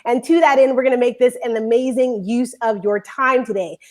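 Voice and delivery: ordinary delivery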